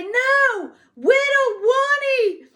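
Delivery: high, loud, slow wailing "No, Whittle Wani"; whiny shouting little boy; frustrated toddler. Retold or imitated. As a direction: cry it out like an insistent four-year-old. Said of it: "'cause he couldn't say his R's".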